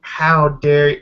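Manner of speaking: ordinary talk, nothing marked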